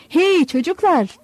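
put-on high, slow shout to the boys; old fisherman hollering at his sons; calling out. A narrator's reading. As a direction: call across the beach, pitched high. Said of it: "diye bağırdı".

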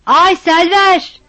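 a drawn-out call at the door, pitched high. Acted. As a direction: call her name long and loud through the closed door. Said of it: "diye bağırdı".

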